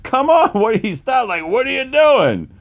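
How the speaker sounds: very high, imitated panic; frantic pleading. Billy urging his friend to flee the stings, frantic.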